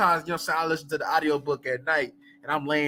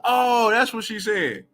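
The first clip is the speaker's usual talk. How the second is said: slow realization; 5 semitones higher, slower than usual. Retold or imitated. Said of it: "I be like".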